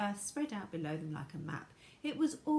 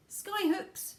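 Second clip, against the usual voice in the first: high Wonka voice; 11 semitones higher, 6 dB louder than usual. A narrator's reading. Wonka's high excited answer.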